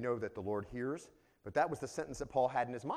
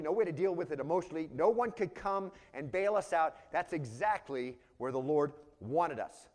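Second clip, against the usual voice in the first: raised, emphatic sermon voice; emphatic preaching; 4 semitones higher, 5 dB louder than usual. Real, not acted. Preacher tells the beloved this is super important, emphatic preaching. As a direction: drive it home to the congregation.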